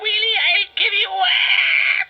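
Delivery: high, furious witch scream. Acted. Witch Fenella screaming in rage at Zuma. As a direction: scream at the little wheelie.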